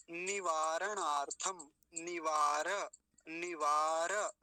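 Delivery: निवारणार्थम and निवार very slow, with a whole ra; full ra. Shown as a demonstration. Teacher breaking the word down, slow full ra. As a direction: stretch निवार into ni-vaa-ra. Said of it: "रे पूरा होगा".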